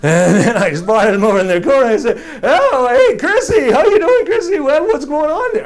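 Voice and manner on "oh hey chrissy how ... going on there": high, loud, put-on chummy hello; butter up a kid you plan to swindle; overfriendly greeting. Boy sidling up to the weakest kid, mock-chummy greeting.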